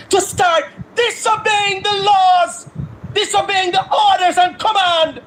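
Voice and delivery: high, shouted, near-tearful rant; enraged citizen shouting for arrests; furious shout. Real, not acted. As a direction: rail against the politicians at full voice. Angry political rant at leaders.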